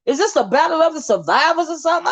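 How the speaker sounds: high, incredulous what-in-the-world; shocked disbelief. Retold or imitated. Vlogger reacting to a heated panel, shocked and high. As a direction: act stunned at the panel drama. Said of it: "I was like".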